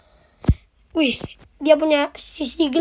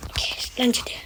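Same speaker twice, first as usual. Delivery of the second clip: whispering; 4 semitones lower, 14 dB quieter than usual. Real, not acted. Streamer whispers aside.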